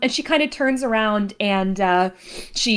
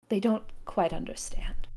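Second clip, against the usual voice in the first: confidential aside; 2 semitones lower, 6 dB quieter than usual. Acted. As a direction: explain it discreetly under your breath. Aside to the butler Bertram.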